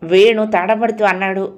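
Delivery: ordinary delivery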